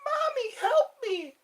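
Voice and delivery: very high squeaky kitten cry; tiny kitten voice. Pretend. Kitten crying for mommy in a very high voice. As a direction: plead like a tiny lost kitten. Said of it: "Try to sound like a kitten".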